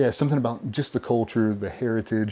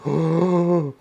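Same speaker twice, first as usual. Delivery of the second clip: long, loud acted gasp; tense gasp; 7 semitones higher, 4 dB louder than usual. Waiting through the name list, tense gasp. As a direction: suck in breath in suspense. Retold suspense during the draft.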